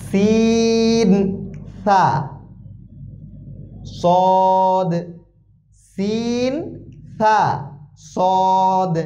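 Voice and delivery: সিন thin and light, সদ thick and full; drilled Arabic letters. Shown as a demonstration. Teacher drills three similar letters for students, slow and exact. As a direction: contrast সিন, সা and সদ with slow held letter names. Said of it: "একই রকম উচ্চারণের মতো, কিন্তু পার্থক্য অনেক".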